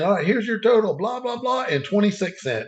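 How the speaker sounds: high mock clerk reading the total; cashier voice. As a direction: pitch up like the chipper cashier.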